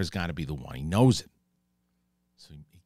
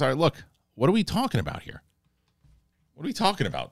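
higher-pitched, put-upon demand; mock exasperation, pitched up; exasperated questioning; 8 semitones higher, faster than usual. King sits down to question the claim, exasperated.

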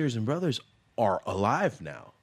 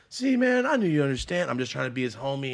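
high, loud opening that settles down; imitated drunk dad; 4 semitones higher, 7 dB louder than usual. Drunk dad to the son humouring him, mock high gushing. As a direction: mimic a wasted dad gushing at his son.

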